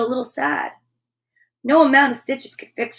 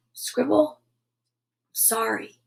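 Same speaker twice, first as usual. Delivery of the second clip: soft, slow, hushed apology; hushed, hesitant storybook sorry; whispered apology; 6 dB quieter, much slower than usual. A narrator's reading. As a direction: say it gently, almost whispering. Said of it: "she whispered".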